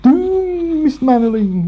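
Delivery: high wailing Scottish "Doomed"; mock Scottish lament, pitched high; Scottish doom impression. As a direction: wail "Doomed" like a gloomy Scot. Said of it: "it's not a very good impersonation of him".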